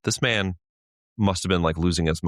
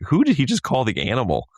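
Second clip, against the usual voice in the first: confused surprise; 8 semitones higher, 3 dB louder than usual. Retold or imitated. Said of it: "And I was like".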